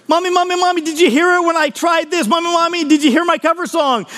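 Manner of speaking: put-on high pleading child voice; eager little girl. Little girl asking her mommy to hear her song, high and eager. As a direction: tug at mommy for praise. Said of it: "little girls, they wanna know".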